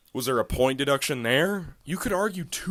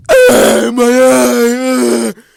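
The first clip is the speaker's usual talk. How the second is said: hammed-up wail, much higher and louder; fake-sob like a hurt fighter, overdone; mock crying; 11 semitones higher, 17 dB louder, much slower than usual. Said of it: "He's crying".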